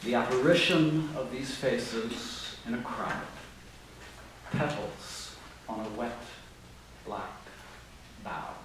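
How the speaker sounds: slow, soft poetry recital; hushed measured reading of the poem. Shown as a demonstration. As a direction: read it slowly, letting each word land. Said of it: "the words are these".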